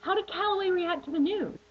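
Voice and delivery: high, curious asking voice; a fan wondering aloud; mock viewer's question. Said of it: "You might be asking yourself".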